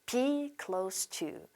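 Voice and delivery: slow, soft "Be close to", s in "close"; model pronunciation. Shown as a demonstration. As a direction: repeat "Be close to" slowly, s not z. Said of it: "한 번 더 할게요".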